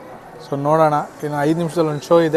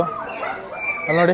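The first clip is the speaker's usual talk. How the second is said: whispering; 7 dB quieter, much slower than usual. Real, not acted. Hushed pointing at scary dinosaur.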